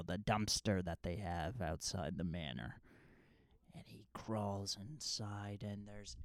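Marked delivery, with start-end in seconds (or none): 3.7-6.2 slow suspenseful whisper. Real, not acted. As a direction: hush it like a creepy secret. Suspense in retold scene.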